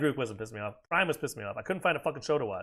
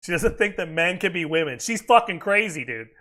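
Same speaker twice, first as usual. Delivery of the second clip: ordinary talk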